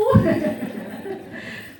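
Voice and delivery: high breathy burst of laughter. Real, not acted. Mother laughing at her son's joke, a high airy laugh.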